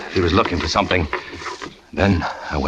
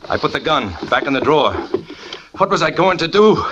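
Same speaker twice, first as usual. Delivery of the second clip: higher, tighter rising fear; a man losing his nerve; rising panic; 6 semitones higher than usual. Acted. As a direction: let the fear climb. Joe panicking about the gun.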